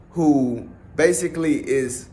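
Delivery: ordinary talk, nothing marked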